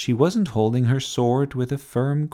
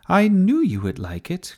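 high-pitched, pleased exclamation; delighted cry; 8 semitones higher, 3 dB louder than usual. A narrator's reading. Sophie to the pleased knight who likes her sword, delighted cry. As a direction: cry it out proudly. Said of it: "cried Sophie".

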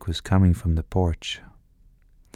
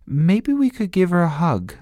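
put-on child voice; 11 semitones higher than usual. A narrator's reading. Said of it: "said Sophie".

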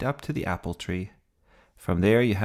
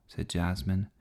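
usual speaking voice

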